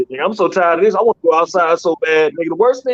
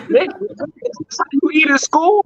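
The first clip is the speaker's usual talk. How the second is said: exasperated dad; 10 semitones higher than usual. Retold or imitated. Father scolding hungry son.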